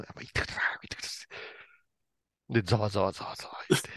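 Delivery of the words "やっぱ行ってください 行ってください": whispered, pressing him to go; hushed urging. Retold or imitated. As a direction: urge him on in a retold whisper. Deputy urges him in hushed tones. (小声でささやくように促す)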